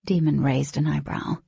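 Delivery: usual speaking voice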